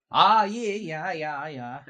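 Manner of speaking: loud, high Swedish-Canadian yeahs, settling down; big Swedish-Canadian character voice; Credence. Acted. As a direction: brush it off in a sing-song Swedish-Canadian accent. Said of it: "I'm good old Swedish Canadian".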